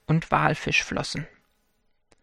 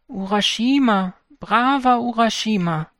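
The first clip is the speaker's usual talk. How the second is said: a higher, strange calling voice; 6 semitones higher than usual. Acted. Unseen turtle calling the fisherman, strange and higher. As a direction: call him from the sea, otherworldly. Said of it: "eine sonderbare Stimme".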